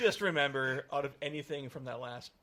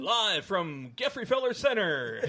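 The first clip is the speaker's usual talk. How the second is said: louder, higher, drawn-out venue call; mock announcer; 5 semitones higher, 5 dB louder, slower than usual. Co-host tops the live opening joke, mock announcer. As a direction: announce it big and slow.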